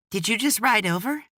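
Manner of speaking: put-on high, bright little boy; an excited kid asking a question; eager child voice. A narrator's reading. Child character voiced by narrator.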